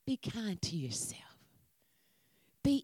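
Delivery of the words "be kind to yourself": whispered. Shown as a demonstration. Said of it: "Look at somebody and say".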